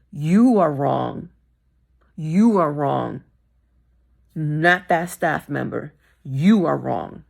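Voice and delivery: firm, deliberate scolding of managers; emphatic verdict. Real, not acted. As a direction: hammer home you are wrong.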